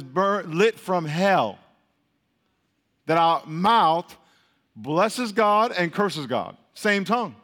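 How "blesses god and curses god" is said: usual speaking voice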